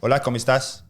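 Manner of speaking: hammed-up Spanish "Hola, ¿cómo estás?"; fake Spanish. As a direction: play the Spanish cousin. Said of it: "And I'd go".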